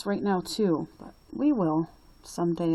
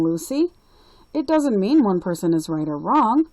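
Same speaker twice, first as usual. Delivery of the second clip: a lightly voiced, louder kind grandpa; a kind grandpa comforting Lucy, a bit louder; grandpa reassuring; 4 semitones higher, 6 dB louder than usual. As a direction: gently set his granddaughter straight as grandpa. Grandpa Papa Gino reassuring.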